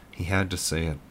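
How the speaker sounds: ordinary delivery, nothing marked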